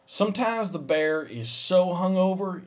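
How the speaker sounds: usual speaking voice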